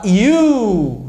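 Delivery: "you" drawn out from a Y glide; Y sound. Shown as a demonstration. Teacher isolates the first sound of university, long Y glide. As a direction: stretch "you" slowly, leaning on the glide. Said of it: "ele tem som do Y".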